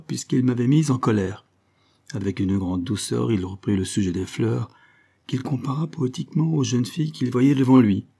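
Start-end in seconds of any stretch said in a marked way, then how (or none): none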